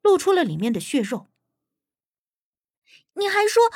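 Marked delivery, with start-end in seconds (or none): 3.1-3.6 high, indignant half-finished scolding; reproachful. Acted. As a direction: start to tell a friend off, pitch jumping up. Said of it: "我刚想埋怨他".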